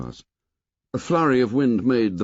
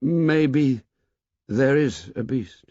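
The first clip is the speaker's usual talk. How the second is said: hesitant maybe, higher and drawn out; 3 semitones higher, slower than usual. A narrator's reading. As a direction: push through the doubt, slowly. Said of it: "he said hesitantly".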